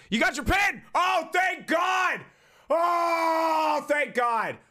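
relieved joyful shout. Real, not acted. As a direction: shout it out, overjoyed the pin made it. Relief that the pin arrived.

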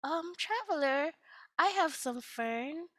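a hint of a shy, hopeful villager; lightly voiced timid asking. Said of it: "another one comes up to the traveler and says".

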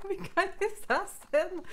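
high, loud delighted squeal; excited shout. Real, not acted. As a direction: shout it out thrilled. Delighted shout at game moment.

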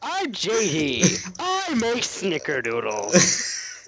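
high, slow, silly mocking voice; exaggerated unmanly parody of a friend; mocking friend impression. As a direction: drag it out in a high voice to tease. Mocking friend's unmanly snickerdoodle baking.

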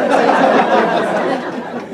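loud real laugh; burst of laughter. Real, not acted. Laughing at the uncle's insistence.

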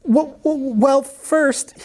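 high, nervous stammer; stammered, stalling for time. A narrator's reading. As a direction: stumble on the first sound before getting it out. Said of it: "he stammered".

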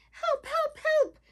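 very high, quick cries; crying for help. A narrator's reading. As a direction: squeal for help like a little turtle in trouble. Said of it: "cried Turtle Turtle".

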